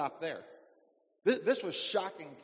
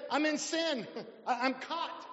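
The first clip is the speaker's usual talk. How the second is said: pleading sinner; 7 semitones higher than usual. Acted. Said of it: "This guy actually said".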